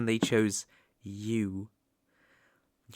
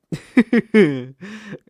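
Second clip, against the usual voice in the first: loud, high, derisive cackle; scornful evil laugh; 8 semitones higher, 9 dB louder than usual. Acted. Demon lord mocking the human's wish to go home, derisive laughter. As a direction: burst out laughing at the idea.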